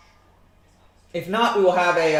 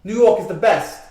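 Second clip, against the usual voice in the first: slow, louder mock New York accent; 6 dB louder, slower than usual. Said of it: "do much of a New York accent".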